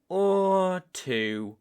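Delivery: high and loud, lean hard on it; ominous emphasis. Acted. Hunter turns to the deadly second option, loud ominous emphasis. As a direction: announce the darker choice with weight.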